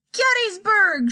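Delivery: high, loud cry of a battle name; excited cry. Acted. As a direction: call it out like a battle won. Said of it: "she cried".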